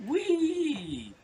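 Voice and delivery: very high, stretched-out "wee"; long high-pitched ghostly squeal; little voice. Retold or imitated. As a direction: mimic a tiny voice squealing, sliding down at the end. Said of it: "hear a little voice say".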